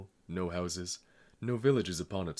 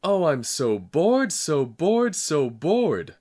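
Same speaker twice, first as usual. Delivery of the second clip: high, slow, even louder wailing howl; 9 semitones higher, 12 dB louder, much slower than usual. A narrator's reading. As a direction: howl it louder than ever. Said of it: "I howled more loudly than ever".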